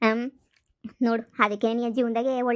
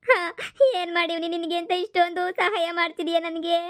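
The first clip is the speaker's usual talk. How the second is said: wailing through tears, pitch raised; tearful crying; 3 semitones higher than usual. Acted. Grandmother overwhelmed by a little grandson's kindness, crying. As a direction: sob out a grateful, guilt-ridden lament. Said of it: "ಯಾಕ್ ಅಳ್ತಾ ಇದೀಯ ಅಜ್ಜಿ".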